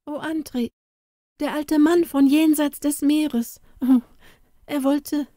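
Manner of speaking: put-on high girl's voice breaking off in tears; tearful girl. A narrator's reading. Inga tearfully telling Andri about the stranger. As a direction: let the words die in crying. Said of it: "in den Tränen ... erloschen ihre Worte".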